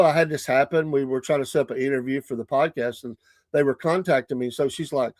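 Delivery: normal talking voice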